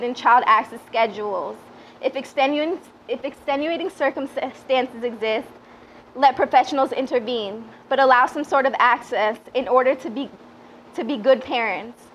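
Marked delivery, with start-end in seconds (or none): none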